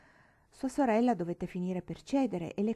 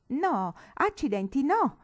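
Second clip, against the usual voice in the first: loud, high, drawn-out protest; grido di protesta; 7 semitones higher, 8 dB louder, slower than usual. A narrator's reading. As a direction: cry out to cut your sister off. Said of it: "gridò la signorina Matilde". (grido di protesta, forte e acuto)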